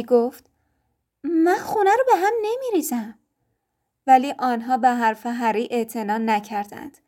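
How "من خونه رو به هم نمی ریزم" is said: put-on high boyish voice pleading; a child insisting he is good; protesting boy. A narrator's reading.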